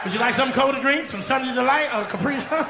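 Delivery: flustered hostess covering guilt with a laugh; nervous hostess. Pretend. Caught off guard by Jesus, a flustered hostess with a nervous laugh.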